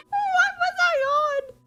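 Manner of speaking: very high, loud, helpless squeal; squealing through laughter. Real, not acted. Reader baffled by her own note, squealing through laughter. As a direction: squeal it while cracking up.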